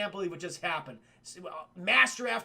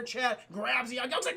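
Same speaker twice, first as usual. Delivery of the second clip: normal talking voice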